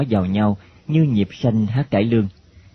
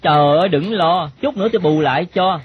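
lightly voiced boy, higher pitch; reassuring boy; 7 semitones higher than usual. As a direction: brush off the girl's worry in a lightly boyish voice. Boy reassures the worried girl.

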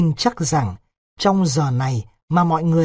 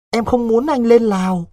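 put-on high-pitched, imploring young woman; pleading girl; 7 semitones higher, 3 dB louder than usual. Vịnh tells Đối she does not want him in Laos, imploring. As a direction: beg him in a higher voice.

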